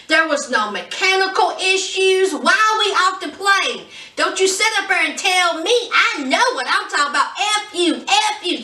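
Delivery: high, heated mock tirade; imitating an angry rant. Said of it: "just going off".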